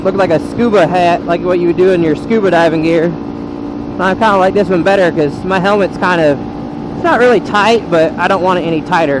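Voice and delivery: ordinary talk, nothing marked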